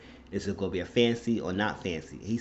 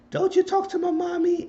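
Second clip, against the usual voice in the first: put-on little boy voice; 6 dB louder than usual. Voicing the little boy Blue.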